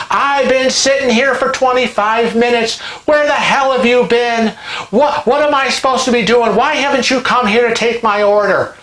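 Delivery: high, angry mock rant at the waiter; retold angry screaming. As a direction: rail at him like an outraged old woman. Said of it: "And she starts screaming".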